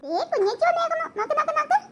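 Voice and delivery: high-pitched cooing patter; baby talk. Acted. Sister holding the baby, playful high baby talk. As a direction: coax the baby to look.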